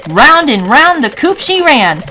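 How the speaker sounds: plain reading voice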